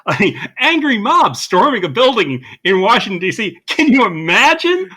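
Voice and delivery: hammed-up incredulity; mock astonishment. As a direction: feign total shock, laying the irony on thick. Ironic shock at a familiar event.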